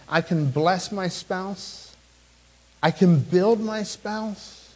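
a slow, deliberate vow to the spouse. Shown as a demonstration. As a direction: bless and build drawn out, very slow. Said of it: "How about your spouse?".

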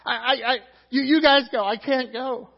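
high, loud, stammering protest; stammer, then push the others away loudly; flustered refusal. Acted. Peter begging off from meeting Jesus, flustered.

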